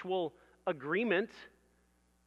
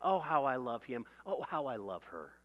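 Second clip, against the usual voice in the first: soft, lower, tender doting father voice; God showing the angels pictures, tenderly doting; tender fatherly love; 7 semitones lower, 6 dB quieter than usual. Pretend. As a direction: coo over your children like a proud father. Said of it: "and saying".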